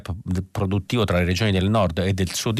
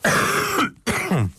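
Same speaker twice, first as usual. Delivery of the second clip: chesty cough. Real, not acted. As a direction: hack out a heavy cough. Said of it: "Scusate per la tosse".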